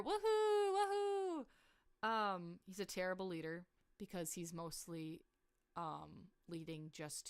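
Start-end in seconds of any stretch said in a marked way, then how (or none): 0.0-2.1 high, loud sarcastic woohoo; mock cheer. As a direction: cheer it in jest, much higher. Mock cheer for evil dictator.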